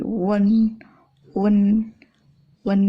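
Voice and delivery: "wen" with an added e sound; ketambahan E. Shown as a demonstration. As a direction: say "wen", u becoming w before en. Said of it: "U ketemu N menjadi ketambahan E". ("wen" dengan bunyi e)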